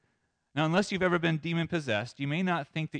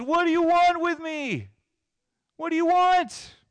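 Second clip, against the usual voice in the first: high, loud possessed shriek; acted demoniac's desperate yell; crazed cry; 7 dB louder than usual. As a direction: scream it, stretching the words. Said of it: "He cried out. He exclaimed".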